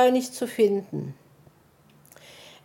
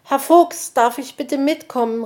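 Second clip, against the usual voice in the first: high, louder call to the fox; eager calling out; 8 semitones higher, 5 dB louder than usual. A narrator's reading. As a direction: call out a hopeful request. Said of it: "rief Borstel".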